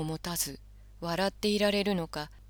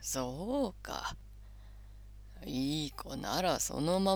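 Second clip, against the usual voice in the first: put-on slow, soft old man, fading to whisper; gentle grandfatherly hush; creaky wise old man; 6 dB quieter than usual. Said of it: "サンタクロースはこう言いました".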